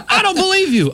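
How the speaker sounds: high-pitched disbelieving shout; retold yelling. Storyteller imitating officer who rejects the car explanation, yelling. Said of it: "just yelling at me".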